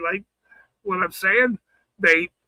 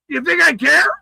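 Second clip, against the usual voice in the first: ordinary delivery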